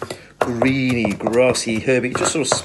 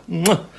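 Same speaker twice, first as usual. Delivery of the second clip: chef's kiss; 7 semitones higher than usual. Real, not acted. Chef's kiss over the fish.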